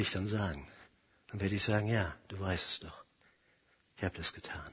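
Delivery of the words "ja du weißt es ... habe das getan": very low and soft, fading away; subdued confession. Acted. The accused believer confesses to God, small and hushed. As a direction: admit it almost inaudibly.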